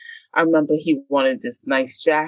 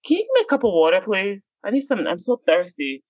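higher, put-on needy voice; mock-innocent girlfriend; fake sweet; 5 semitones higher, faster than usual. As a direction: distract him with a sweet plea. Pretending thirst to distract.

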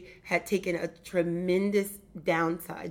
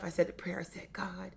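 breathy hushed whisper, voice dropping to nothing; 9 dB quieter than usual. Retold or imitated. Woman whispering the prayer she said to God, hushed. Telling of her quiet prayer.

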